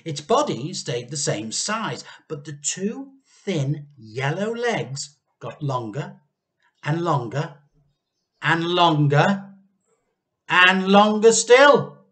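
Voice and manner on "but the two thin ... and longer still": slow, louder and louder each longer; building suspense. A narrator's reading. Narrator building wonder as the legs grow, slowing and swelling.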